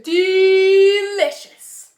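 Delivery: delicious stretched long and loud; delighted drawn-out rave. Real, not acted. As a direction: savor the word delicious slowly. Raving about taste.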